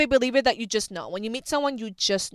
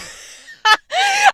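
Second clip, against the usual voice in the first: high, loud burst of laughter; squealing giggle; shrieking laugh; 12 dB louder than usual. Real, not acted. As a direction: crack up at the absurdity. Laughs at peanut butter reveal.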